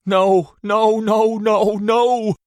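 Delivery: high, loud sobbing cries; sobbing shouts. A narrator's reading. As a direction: wail it through sobs. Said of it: "I sobbed".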